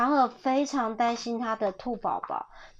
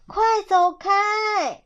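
high, loud, drawn-out order; urgent storybook yell; shooing shout; 9 semitones higher, 5 dB louder, much slower than usual. A narrator's reading. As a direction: drive the intruder off. Mother drives fox away with broom.

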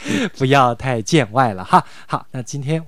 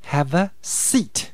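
"Have a seat" slow, word by word; slow careful take; 4 semitones higher, much slower than usual. Shown as a demonstration. Teacher introducing the phrase to learners, slow and careful. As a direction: spell out "Have a seat" slowly with a long ee in "seat". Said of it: "我念得再快".

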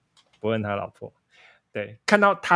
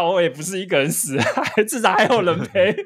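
laughing; 6 semitones higher than usual. Retold or imitated. Joking about dying together.

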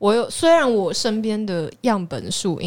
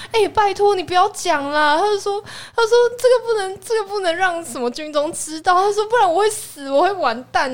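high-pitched, louder imitation of a panicked plea; pleading ex-boyfriend, higher and louder; mock begging ex; 12 semitones higher, 3 dB louder than usual. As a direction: whine it like a scared boyfriend begging. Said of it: "他又开始求我了".

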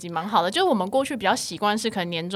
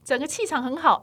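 high, bright-eyed gushing praise; proper compliment; 12 semitones higher, 7 dB louder than usual. Shown as a demonstration. Demonstrating how relatives should greet her, high and admiring. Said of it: "那你就不能好好说".

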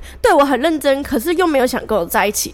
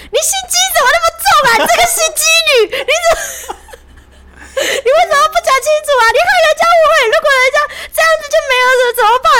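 much higher, fast, hammed-up scolding; mock scolding boyfriend; 5 dB louder, much faster than usual. Boyfriend berating his girlfriend, hammed-up high fast mock scolding. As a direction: parody his nagging in a much higher, rapid voice. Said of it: "然后他就骂他女朋友说".

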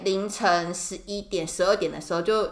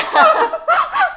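short high real giggle; 7 dB louder than usual. Real, not acted. Giggling at her co-host's joke. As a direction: burst into a giggle.

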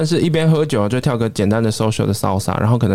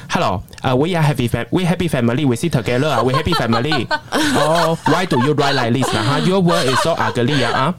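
higher-pitched Singlish English, laughing; imitating a Singaporean passenger's Singlish; Singaporean accent; 4 semitones higher than usual. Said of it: "然后我去模仿他". (新加坡口音英语)

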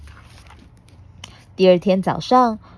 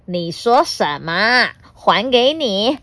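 menacing villain; 7 semitones higher, 4 dB louder than usual. Acted. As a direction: sneer and challenge him. Devil dragon taunts T-rex.

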